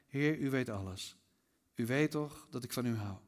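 soft, subdued reply with a touch of hurt; saddened. A narrator's reading. Peter answers Jesus after the third question, saddened. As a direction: answer low and quietly, mildly grieved. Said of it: "Petrus werd verdrietig".

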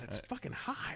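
muttered. Real, not acted. Complaining about the heat.

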